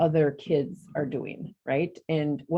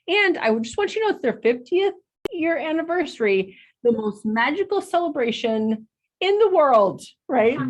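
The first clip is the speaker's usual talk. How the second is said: mock announcer; 10 semitones higher, 3 dB louder than usual. Mock announcer of park slogan.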